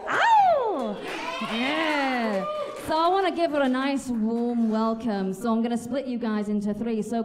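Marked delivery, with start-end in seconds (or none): 0.1-1.0 a high, loud "ow" to pump the crowd; hype yelp. Real, not acted. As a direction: yelp it out to get them going. Hyping crowd for new act.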